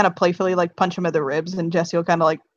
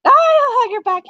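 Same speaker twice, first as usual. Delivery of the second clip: high, louder squeaky hug line; giddy high voice; 5 dB louder than usual. Acted. Fox squeaks happily back at Kate's hug. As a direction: squeal it like a delighted little fox.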